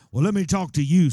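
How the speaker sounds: usual speaking voice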